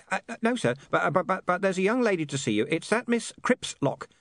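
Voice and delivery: high, nervous stuttering; nervous high-pitched underling; flustered stammer. Acted. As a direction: stammer out the message to the boss. Flustered overseer reports to boss.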